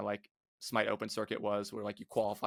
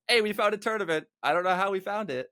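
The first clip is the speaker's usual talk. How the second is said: higher, louder, eager call-out; acted excited announcement; 8 semitones higher, 5 dB louder than usual. Player telling the team they found a tournament, excited call-out. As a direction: announce the find to the team, voice lifted.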